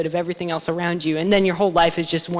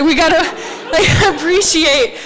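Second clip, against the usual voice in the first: loud, high, laughing outburst; overjoyed; 10 semitones higher, 10 dB louder than usual. Retold or imitated. Storyteller finally holding the mussel shell, overjoyed. Relives own happiest moment, laughing.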